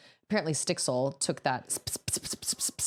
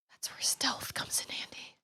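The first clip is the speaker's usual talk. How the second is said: whispering; 8 dB quieter than usual. Real, not acted. Streamer whispers throughout.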